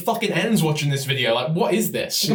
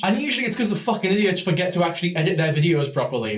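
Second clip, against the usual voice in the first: a quicker, run-together first take of the line; rushed take; faster than usual. Acted. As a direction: rattle it off a bit fast. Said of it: "don't do that again".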